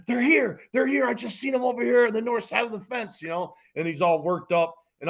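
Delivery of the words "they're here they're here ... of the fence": put-on young villager bursting in to warn of bandits, frantic; excited young kid. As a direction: burst in out of breath with news. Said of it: "he's all excited. He's all worked up".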